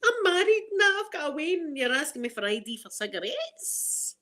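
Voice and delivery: high, indignant squeal that fades; mock outrage at being carded; indignant outburst. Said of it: "I'd be like".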